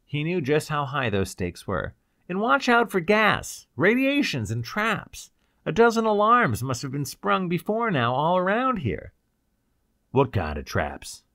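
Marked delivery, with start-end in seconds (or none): 2.3-9.1 high, anxious alarm; urgent warning. Acted. Ryder warns Cloud about traps, urgent and high. 10.1-11.2 low, firm question; demanding. A narrator's reading. Cloud questions the captive about traps, demanding. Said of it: "Cloud demanded".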